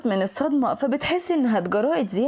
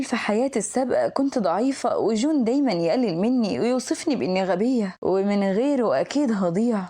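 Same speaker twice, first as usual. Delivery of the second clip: ordinary delivery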